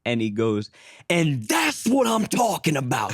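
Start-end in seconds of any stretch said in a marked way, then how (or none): 1.1-3.1 high, slow, stretched imitation of a shouting boss; hammed-up boss outburst. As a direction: belt out the punchline as the worked-up boss. Retold boss erupts at the punchline.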